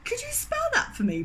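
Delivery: very high, sweetly polite ask; polite request. Retold or imitated. Narrator asking the baker to spell his name, very polite and high. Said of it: "if I'm being polite".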